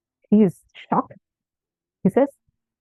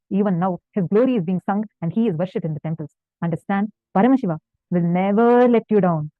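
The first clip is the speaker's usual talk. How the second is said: usual speaking voice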